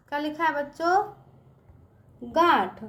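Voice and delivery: normal talking voice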